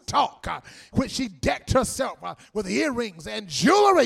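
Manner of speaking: loud, rolling preacher's cadence; preaching at full force. Real, not acted. Preacher rousing the congregation about healing the outcasts, full-force preaching. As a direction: preach it out to the whole church. Preaching Hosea with call-outs.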